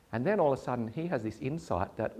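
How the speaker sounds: usual speaking voice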